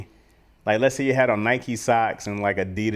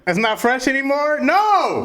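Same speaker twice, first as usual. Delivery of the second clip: high-pitched, loud mock protest; mock dismay; 5 dB louder than usual. Fan losing favourite slang, high mock dismay. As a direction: protest in exaggerated high disbelief. Retold upset at slang change.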